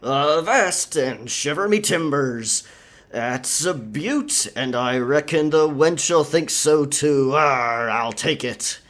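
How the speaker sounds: put-on over-the-top pirate accent; hammed-up pirate speak. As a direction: play a bad stage pirate to fool the merchant. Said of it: "the pirate speak".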